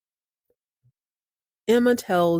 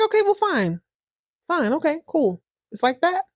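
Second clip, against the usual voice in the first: high-pitched huffy comeback; mock pissed; 10 semitones higher than usual. Husband turned down by Maggie, mock pissed. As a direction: play put-out in a raised voice. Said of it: "He's pissed".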